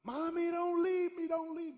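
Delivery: put-on high, loud toddler pleading; high frantic child begging mommy not to go; child's scream. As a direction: scream it like a little boy whose parents are leaving. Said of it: "I would scream when they".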